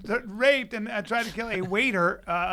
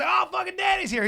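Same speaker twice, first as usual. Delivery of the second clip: high, hyped partygoer; retold party buzz, pitched way up; excited announcement; 10 semitones higher than usual. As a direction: announce the wild guy's arrival. Partygoers react to Danny arriving.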